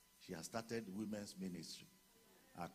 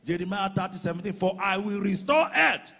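high roaring preacher's shout, dragged out slowly; preaching at full force; 13 semitones higher, 26 dB louder, slower than usual. Acted. Pastor proclaiming healing scripture over the congregation, roaring.

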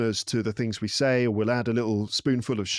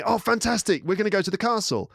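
much higher, excited imitation of wife; mock delight; 10 semitones higher than usual. Wife misreading dad's email to husband, delighted. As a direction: gush as if the outing is settled.

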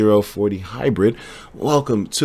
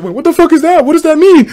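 much higher, baffled newcomer voice; confused newcomer; 4 dB louder than usual. Retold or imitated. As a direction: play a lost new viewer asking what it means. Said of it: "new people will be like".

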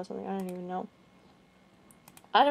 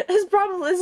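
a burst of laughter, much higher and louder; laughing; 11 semitones higher, 6 dB louder than usual. Real, not acted. Laughing at her own problem while explaining it. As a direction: break into laughter on the words.

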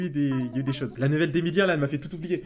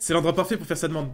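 ordinary talk, nothing marked

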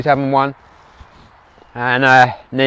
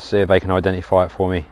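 ordinary delivery

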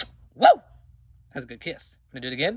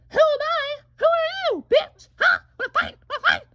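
very high and loud, picking a fight; high squeaky fighting voice; 8 dB louder than usual. Acted. Little creature squaring up to the stranger, high and loud. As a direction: squeak out a challenge.

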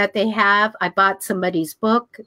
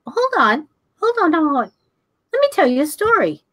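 higher-pitched replay of cutting someone off; urgent interruption; 7 semitones higher than usual. Retold or imitated. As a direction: stop her mid-talk to tell your story. Acted interruption of mentor.

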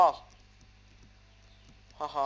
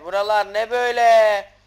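Buralar ne böyle shouted, louder and higher; loud amazed shout; 5 semitones higher, 15 dB louder than usual. Real, not acted. Gamer shouting at a strange new area. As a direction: blurt it out loud in disbelief. Repeat, much louder second take.

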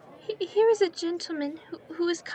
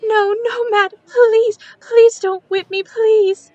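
sobbing, much louder, desperate begging; scream-cry the plea as if a whip were raised; 4 semitones higher, 12 dB louder than usual. Acted. Child begs not to be whipped.

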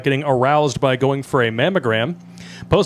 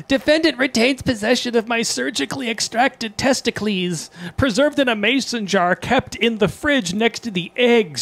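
higher mocking read of quote; 10 semitones higher than usual.

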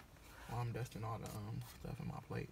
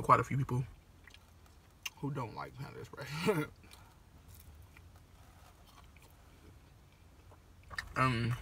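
chewing while laughing; eating egg roll while speaking; mouth full; 3 dB louder than usual. Real, not acted. Seen 1: Bites egg roll at 0.8–2.8s, then chews with cheeks full.

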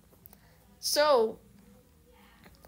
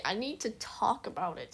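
softer, drawn-in echo of the line; quiet repeat; 7 dB quieter than usual. Real, not acted. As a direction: say it again to yourself, softer.

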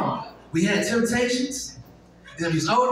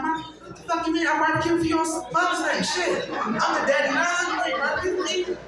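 indignant shout at mom; 8 semitones higher than usual. Retold or imitated. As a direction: protest like a grown son who's the daddy now. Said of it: "I'm like".

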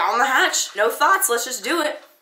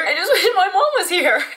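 higher, louder, tearful laugh breaking through; crying but laughing at herself; real tears, choked laugh; 9 semitones higher, 5 dB louder than usual. Real, not acted. Crying for her mom alone.

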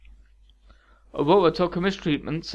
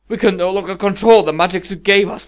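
loud, raised protest; an agitated, higher-pitched objection; 5 semitones higher, 9 dB louder than usual. A narrator's reading. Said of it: "the king protested".

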